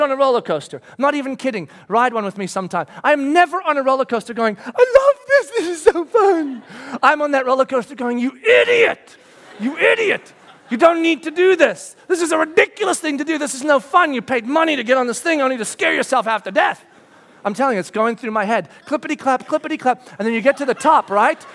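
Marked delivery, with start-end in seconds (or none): 4.6-6.6 mock delight. Mock thrill-seeker on coaster. 8.2-16.8 panicked self-scolding. Retold or imitated. Scolding himself on a coaster.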